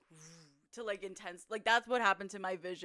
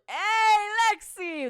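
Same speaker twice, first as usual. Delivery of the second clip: high, loud call-out; excited greeting; 12 semitones higher, 10 dB louder, much slower than usual. Real, not acted. Streamer welcoming a viewer mid-story, excited shout. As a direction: holler hello to someone arriving.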